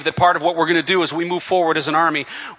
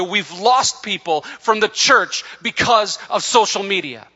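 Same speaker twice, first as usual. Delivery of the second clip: emotional preaching; 4 semitones higher than usual. Real, not acted. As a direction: preach it with your heart breaking through. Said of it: "I get emotional over that part".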